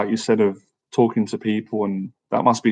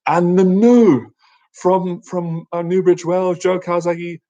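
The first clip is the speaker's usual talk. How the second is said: ring announcer; 7 semitones higher, slower than usual. Retold or imitated. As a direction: draw out the big result like a ring announcer. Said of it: "when they went".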